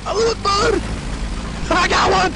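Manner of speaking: high, loud hyped rally; hyped soldier voice. Pretend. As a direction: pump up the squad. Hyped squad rally, then kill.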